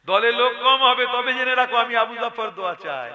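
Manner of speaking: fervent shouted blessing. Retold or imitated. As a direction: ring it out like a preacher's promise. Preacher voices elder's fervent blessing.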